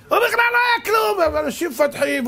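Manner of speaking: high-pitched emphatic shouting; worked-up preacher's cry; exasperated outcry. Real, not acted. Worked up over imagined grievance.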